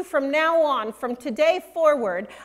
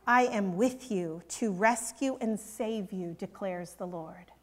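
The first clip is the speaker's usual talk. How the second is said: hushed reverent quote; 7 semitones lower, 9 dB quieter than usual. Acted. Preacher quoting God's promise to Jeremiah, hushed. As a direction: lower and much softer, fading on "declares the Lord".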